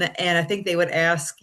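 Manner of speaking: ordinary delivery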